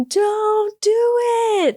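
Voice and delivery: high, drawn-out mock yell; mock rooftop shout. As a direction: call it out as if from a rooftop, without real volume. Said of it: "shouting from the rooftop".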